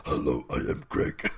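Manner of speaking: very low, creaky monster greeting; deep, ponderous beast introducing itself; mock monster. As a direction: drop way down into a comic bugbear voice. Mock monster with ordinary name.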